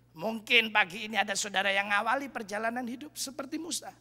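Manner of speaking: usual speaking voice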